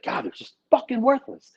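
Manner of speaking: high-pitched exasperated venting; mock-exasperated outburst; retold exasperation. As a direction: throw it out in high-pitched disgust, quoting your own reaction. Venting about past problem people.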